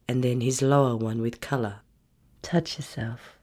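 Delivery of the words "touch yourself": hushed order; soft command. Acted. Dominant wife commands softly.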